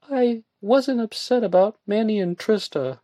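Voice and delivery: high young woman's voice, lightly acted; Joanna's voice. A narrator's reading.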